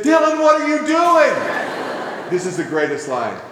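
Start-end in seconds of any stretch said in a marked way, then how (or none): 0.0-2.3 high, loud, slow shocked cry; a startled mom calling out across the aisle; mother shouting at her son in the store, high and slow; mother's shocked shout. Retold or imitated. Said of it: "she said".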